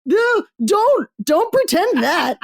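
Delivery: shrieking high and loud in mock horror; high-pitched mock panic shout; horrified high shout. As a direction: cry out in dismay, high and loud. Horrified reader pleading.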